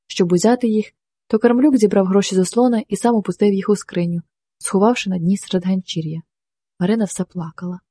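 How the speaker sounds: ordinary delivery, nothing marked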